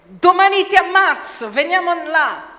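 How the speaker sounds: high, loud threatening voice; acted threat. Persecutors threaten the church, acted loud menace. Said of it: "gente che dice".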